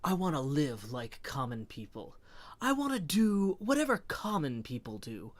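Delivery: dramatic spoken-word delivery with deliberate stops; William Shatner. Shown as a demonstration. Streamer demos an impression for a spoken-word album, theatrical Shatner-style delivery. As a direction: recite it as overwrought spoken-word poetry. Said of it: "like, a Shatner impression at that point".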